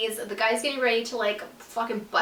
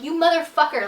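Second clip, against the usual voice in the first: higher, louder "You motherfucker"; angry curse; 5 semitones higher, 5 dB louder than usual. Retold or imitated. Actress cursing the male performer right after cut, angry and louder. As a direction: snap it at him as the cameras cut.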